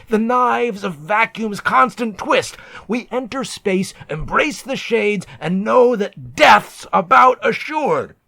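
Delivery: rising fury until you yell the last line; shipmates' angry shout. Acted. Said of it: "my mates informed me".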